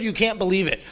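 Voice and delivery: ordinary talk, nothing marked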